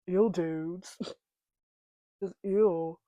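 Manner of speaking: lightly voiced deadpan teen nerd; a touch of movie-nerd disgust; Napoleon Dynamite impression. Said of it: "talking like that. Napoleon Dynamite".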